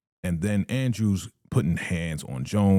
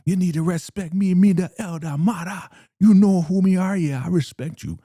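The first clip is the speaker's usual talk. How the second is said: very high, loud, outraged demand for respect; indignant El Damara; 10 semitones higher, 7 dB louder than usual. Retold or imitated. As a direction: screech it back in his face.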